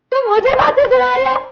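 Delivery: very high, loud incredulous reproach; outraged shout. Acted. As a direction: scream the reproach at your husband.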